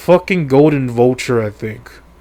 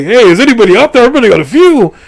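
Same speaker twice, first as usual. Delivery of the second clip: loud, high shout from afar; calling out; 8 semitones higher, 6 dB louder than usual. Retold or imitated. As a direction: holler down to anyone out there. Helicopter man calls out below.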